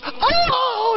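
very high fainting oh; Mary gasps before fainting, high squeal; mock faint gasp. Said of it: "she goes".

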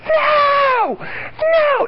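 very high shrieked No, No; high-pitched mock tantrum yell; imitating a toddler scream. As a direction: shriek it like a furious three-year-old. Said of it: "screams at the top of her lungs".

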